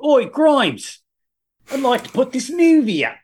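hammy fake South African voice; South African accent. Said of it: "That's my terrible South African accent".

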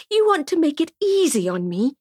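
high, acid, incredulous mock; bitter sarcasm. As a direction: throw his words back at him scornfully. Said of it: "Acid drips from her laugh".

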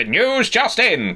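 mock breaking-news announcer; old-time Atlantic-accent news bulletin; newsreel announcer. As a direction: put on an old newsreel headline voice. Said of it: "Oh, yeah, if I'm doing the".

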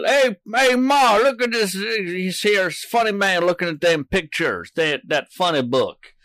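high, lightly put-on hillbilly voice. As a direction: gawk like a country bumpkin. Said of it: "why do they, uh, look".

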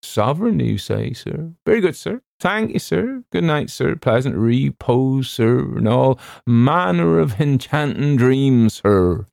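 high, obsequious steward's voice; delighted servant, drawing out the farewell. A narrator's reading. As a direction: gush deferential thanks, pitch well up. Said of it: "delighted at being released".

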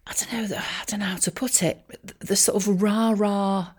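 ordinary delivery

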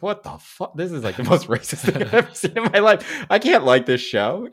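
high, loud shocked disbelief; incredulous outburst. Retold or imitated. Retelling watching the show, shocked outrage. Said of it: "I was like".

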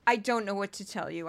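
usual speaking voice

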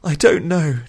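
high, loud, upset outburst; near sobbing. A narrator's reading. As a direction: blurt it out on the verge of sobbing. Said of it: "nearly started to sob again".

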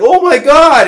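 high, loud gasping oh my God; the man acted out, shocked and loud; mock shock. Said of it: "then he's like".

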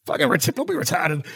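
hammed-up early Boston accent, pitched high; mock colonial Boston accent. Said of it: "have you heard them? They sound".

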